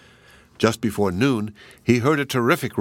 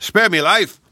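high, loud desperate pleading; giant crying for mercy; 9 semitones higher, 7 dB louder than usual. Acted. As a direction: scream for mercy with your head in the ground. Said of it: "cried the giant".

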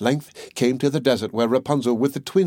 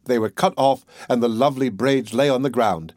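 usual speaking voice

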